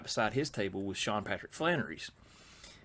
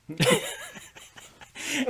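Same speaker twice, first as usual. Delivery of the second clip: laugh; 5 dB louder than usual. Real, not acted. Laughing at the punchline.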